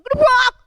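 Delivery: loud, high crash noise; explosive storytelling sound effect; imitated crash. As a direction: blurt a sudden bang.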